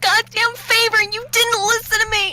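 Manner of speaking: high shrieking rebuke; angry yell. Acted. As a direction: scream the blame at the party. Nick yelling at crew.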